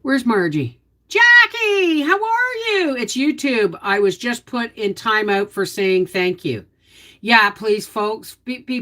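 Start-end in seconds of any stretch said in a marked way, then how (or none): 1.1-3.0 high, louder cheery hello; high sing-song greeting. Real, not acted. Hosting a live chat, greeting a viewer by name, high and cheery. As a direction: sing out a welcome to Jackie.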